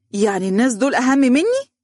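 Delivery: high, indignant question climbing at the end; angry. A narrator's reading. Woman kept waiting challenges her fiancé, angry. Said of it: "عقدت ذراعيها بغضب قائلة".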